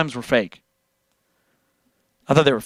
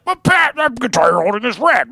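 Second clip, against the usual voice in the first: high, loud mock-viewer voice; exaggerated whiny-protest impression; mocking objector; 9 semitones higher, 4 dB louder than usual. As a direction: imitate a silly nitpicking viewer, pitched way up. Mocking viewers who will object.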